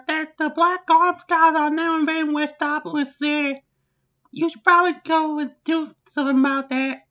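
high, loud put-on aide's voice; aide Barbara. Aide Barbara reporting to the President, high put-on voice. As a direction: play the frantic aide reporting in.

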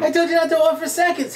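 high-pitched exasperated reproach; scolding wife. Acted. Joke wife reminds husband of her instruction, high-pitched scolding.

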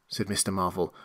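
ordinary delivery, nothing marked

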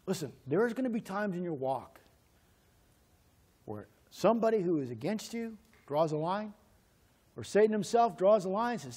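ordinary talk

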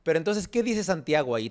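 ordinary delivery

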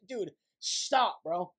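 ordinary delivery

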